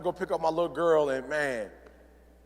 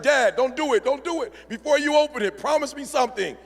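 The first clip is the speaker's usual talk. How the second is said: eager pleading son; 7 semitones higher than usual. Retold or imitated. Son stalls dad before report card.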